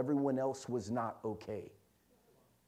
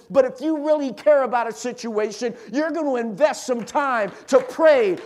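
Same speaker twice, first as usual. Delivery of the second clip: loud, high, punched-out sermon phrases; full-force preaching; 8 semitones higher, 9 dB louder than usual. Real, not acted. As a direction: preach it up to an urgent peak.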